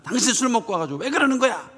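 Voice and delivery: high, fast, exasperated rebuke; mother scolds father for drinking; scolding wife. Retold or imitated. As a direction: snap at the husband who came home drunk.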